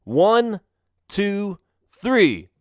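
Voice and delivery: high, spaced-out count; shouted countdown. Acted. Melvin counts down to the dynamite blast, shouting. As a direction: call out each number. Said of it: "Melvin shouted".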